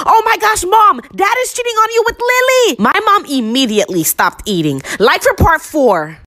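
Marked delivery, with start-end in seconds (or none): 0.1-2.8 retold scream. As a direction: blurt it out in a high imitation shriek. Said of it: "I screamed".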